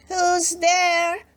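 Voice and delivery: put-on Grandma calls from her bed, high and old. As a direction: call from the bed like a frail granny. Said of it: "cried Grandma".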